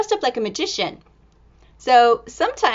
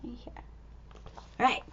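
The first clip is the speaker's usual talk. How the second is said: ordinary delivery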